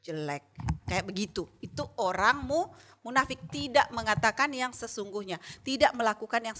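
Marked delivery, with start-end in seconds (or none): 0.0-0.4 low, much softer sneer; hypocrite's muttered inner sneer, low and soft; inner thought. Retold or imitated. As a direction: think the insult to yourself. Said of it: "dalam hatinya".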